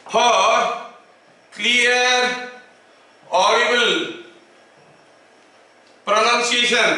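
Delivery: each word spaced out with long pauses; slow dictation. Shown as a demonstration. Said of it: "dictating slowly to class". (धीरे-धीरे लिखवाना)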